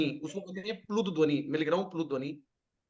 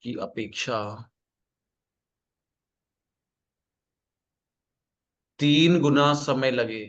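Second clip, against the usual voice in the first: lower, slow dictation, phrase by phrase; 8 semitones lower, much slower than usual. Acted. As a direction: dictate each phrase slowly so students can write.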